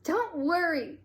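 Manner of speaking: loud, high outburst; exploding. A narrator's reading. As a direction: burst out in anger. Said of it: "Colleen exploded".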